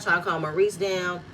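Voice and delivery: ordinary talk, nothing marked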